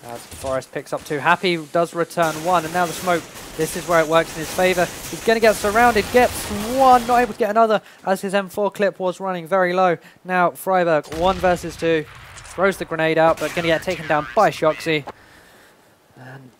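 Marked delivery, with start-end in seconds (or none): 1.2-13.2 rising excited clutch call; esports caster. Real, not acted. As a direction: build it up high and louder.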